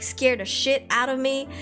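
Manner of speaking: normal talking voice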